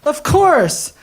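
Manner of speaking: high, loud and excited, drawn out; suddenly thrilled, it all makes sense; delighted driver. Retold or imitated. Taxi driver's delighted realization.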